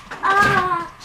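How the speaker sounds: high, drawn-out "ah" yell; sudden yell. Real, not acted. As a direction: cry out high on one long open vowel. Sudden cry before the break.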